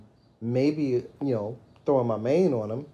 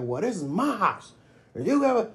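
mock angry dad; 8 semitones higher than usual. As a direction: play the offended man of the house. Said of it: "get all up in arms and be like".

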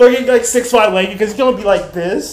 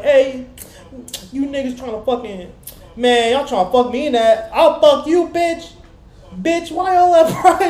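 mock yelling naked guy; 6 semitones higher than usual. Naked man mouthing off to police, high mock yell.

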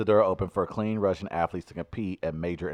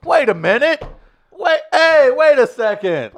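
high, loud comic protest; mock outrage; 14 semitones higher, 14 dB louder than usual. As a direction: stop them in loud mock disbelief. Acted challenge to a doping Paralympian.

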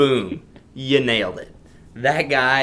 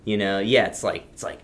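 usual speaking voice

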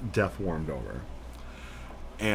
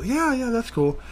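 eager agreement; 13 semitones higher than usual. Retold or imitated.

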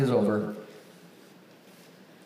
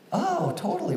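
fake recognition. Faking he knows her.

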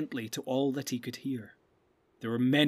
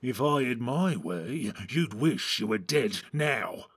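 second orc voice; slower than usual. A narrator's reading. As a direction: threaten him slowly, like an orc. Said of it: "said the other".

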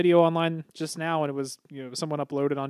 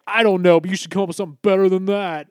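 loud, higher, fast mocking voice; mock coworker; 5 semitones higher, 8 dB louder, faster than usual. Man mocking his coworker's smug movie complaint, loud and fast. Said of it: "he was literally like".

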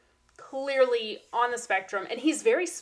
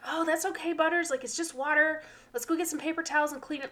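put-on higher, sweet reassuring kid voice; super sweet; 7 semitones higher than usual. Classmate reassuring Butters about spilt water, super sweet. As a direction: imitate a kind child smoothing things over. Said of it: "he was being super sweet about it".

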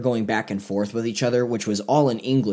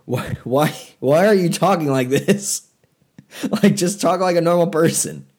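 exasperated; 7 semitones higher, 4 dB louder than usual. Retold or imitated. Exasperated at the overacting actors.

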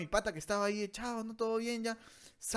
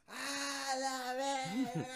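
put-on drunk wail; 4 semitones higher, 8 dB quieter, much slower than usual. Drunk cry on way to bathroom.

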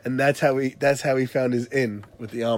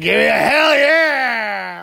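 slow, drawn-out party shout; rowdy drunk cheer; 8 semitones higher, 6 dB louder, much slower than usual. Retold or imitated. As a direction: yell it like a drunk reveler. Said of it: "he was like".

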